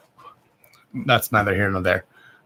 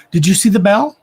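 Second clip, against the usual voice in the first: higher and louder, startled by the bell; startled exclaim; 8 semitones higher, 10 dB louder than usual. Real, not acted. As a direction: burst out, amazed the bell rang.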